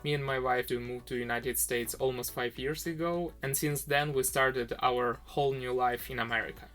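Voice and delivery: usual speaking voice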